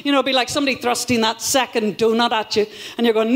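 usual speaking voice

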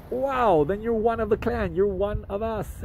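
high, happy embrace of a newcomer; joyful welcome. Retold or imitated. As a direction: welcome him into the family, beaming. Dave embraces the cop, retold.

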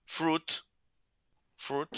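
fruit said like fruta without the final A; fruit pronunciation model. Shown as a demonstration. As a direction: say fruit as a short single syllable. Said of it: "sería como decir fruta, sin la A, fruit".

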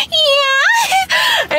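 high squealing laugh into a shrieked yeah; excited squeal. Real, not acted. Vlogger squealing with delight over her tacos. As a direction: squeal with glee over the food. Excited about finally getting food.

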